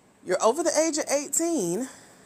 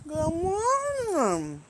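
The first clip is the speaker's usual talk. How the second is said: slow, high stretched good morning; sing-song greeting; 6 semitones higher, much slower than usual. Real, not acted. Drawn-out greeting to viewers.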